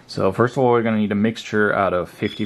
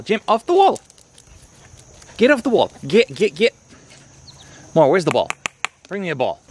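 calling the dogs; 10 semitones higher than usual. Real, not acted.

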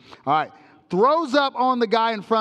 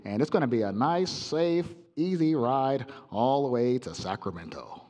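low, muffled intercom captain; mumbling pilot; 11 semitones lower, 6 dB quieter than usual. Retold or imitated. Preacher mocks the pilot's announcement, low mumbled intercom drone. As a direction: drone it like a pilot nobody understands. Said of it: "why do they all sound like that".

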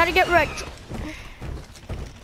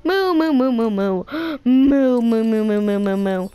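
cow mooing; 4 semitones lower than usual. Pretend. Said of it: "I'm a cow".

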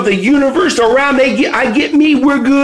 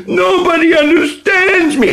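higher-pitched self-pitying complaint; mock whining; 7 semitones higher than usual. Coach mimicking own teen years of complaining, mock whining. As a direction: whine it like a misunderstood teen. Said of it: "I whined".

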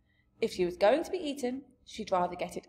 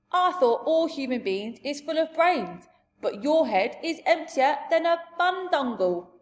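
loud, raised giant voice; big giant voice, higher and louder; scornful giant; 6 semitones higher, 6 dB louder than usual. Acted. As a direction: scold like a blustering giant. Giant scolds Sophie in story.

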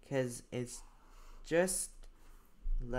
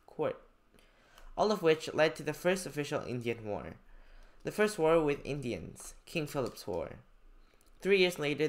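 clear, louder classroom reading of a passage; reading aloud; 6 dB louder than usual. Acted. Teacher reading a story passage to students, clear read-aloud. Reads history text aloud.

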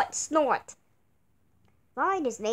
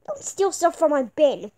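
normal talking voice

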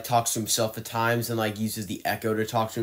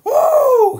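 gasp and woo; 12 dB louder than usual. Real, not acted. Reacting to Terrifier clown's look.

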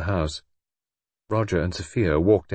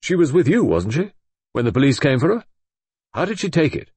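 eager questions; 7 semitones higher, 5 dB louder than usual. A narrator's reading. Roger eagerly questions Sophia.

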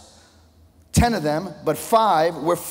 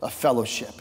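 tender voice of the Lord; 8 semitones lower than usual. Retold or imitated. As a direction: sink the voice down low and gentle. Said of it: "I heard the Lord say this".

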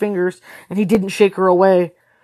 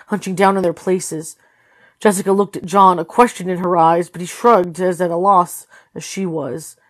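ordinary delivery

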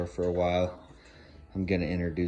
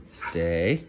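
ordinary talk

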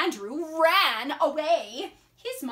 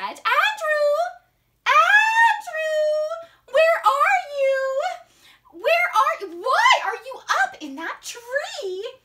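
mom calling out; 13 semitones higher, 8 dB louder than usual. Acted. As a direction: holler for your missing child. Mom calling for lost son.

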